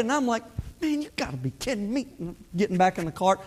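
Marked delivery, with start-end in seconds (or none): 0.8-2.1 quick, hushed grumble of frustration; aggravated muttering. Retold or imitated. Golfer to himself after missing the green, aggravated muttering. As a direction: grumble it fast under your breath. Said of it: "And I'm aggravated".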